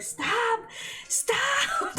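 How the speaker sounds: very high, drawn-out squeal; mock-scandalised squeal. Telling herself off for finding him sexy, mock squeal.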